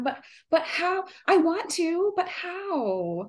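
put-on high, whiny childish pleading. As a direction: whine like a reluctant child. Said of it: "this whiny voice in my brain going".

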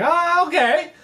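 high, rising acted acceptance; suddenly agreeable. Fishermen hearing they will get paid, suddenly agreeable.

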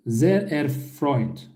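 Sehr erfreut drawn out slowly; slow model phrase. Shown as a demonstration. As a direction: say Sehr erfreut slowly so learners can repeat. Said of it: "karşılık bunu verebilirsiniz". (yavaş, uzatılmış örnek)

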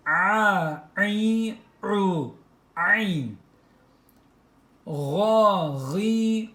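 "A, i, u" pressed from the throat; "Ga, gi" throaty syllables; throat letter drill. Shown as a demonstration. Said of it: "Boğaz harfimiz".

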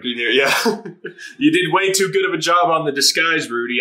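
ordinary talk, nothing marked

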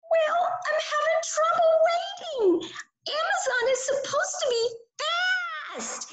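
very high, fast whiny puppet complaint; high puppet kid, building up to mock anger; impatient puppet. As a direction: fidget and fuss about the late package. Puppet Burley whines about waiting.

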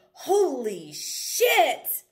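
high, loud and drawn out, imitating an excited guy; excited exclamation. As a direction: stretch "Holy shit" out slowly in a stranger's amazed voice. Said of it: "all excited".

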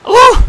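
very high, loud squeal; a startled goat-boy yelp; panicked yelp. A narrator's reading. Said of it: "Grover yelped".

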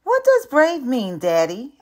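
put-on high, loud small boy asking; boy asking his dad in the barbershop, high and eager; child's question. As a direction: ask like a curious little kid. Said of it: "I ask".

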